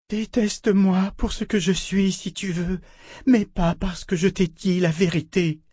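high, pained pleading; tearful appeal. A narrator's reading. Shota asks Richard not to hate her for truth, tearful. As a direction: plead as if through tears. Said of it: "en larmes elle aussi".

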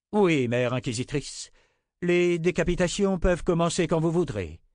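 soldat au rapport. A narrator's reading. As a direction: report to your superior like a soldier. (militaire au rapport, voix haute et soutenue)

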